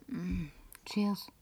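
tonlos gehaucht; geflüstertes Prosit. Acted. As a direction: leise anstoßen. Prostet leise zu. (flüstert dem Barmann ein Prosit zu)

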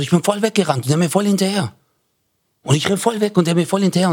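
high, fast, excited retelling; acted breathless-pace enthusiasm; overmotivated guy. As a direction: rush it out as the joke's overmotivated guy. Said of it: "so total übermotiviert".

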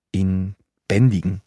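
plain reading voice

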